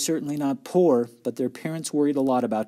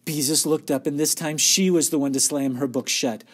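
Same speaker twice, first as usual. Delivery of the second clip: plain reading voice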